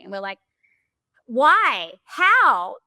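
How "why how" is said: higher and louder mock outrage; retold cry of disbelief, pitched up; indignant disbelief. Retelling their shocked reaction to the lawyer's warning, high and loud.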